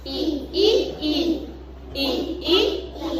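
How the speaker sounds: "I" as a pure "ee" vowel; letter-sound I. Shown as a demonstration. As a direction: say "I" as "ee" in separate, evenly spaced beats. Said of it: "Qual é o som da letra I".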